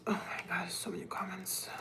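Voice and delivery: very soft whisper; hushed, barely audible aside to yourself; whispered amazement. Real, not acted. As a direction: whisper it under your breath. Murmuring to self over many comments.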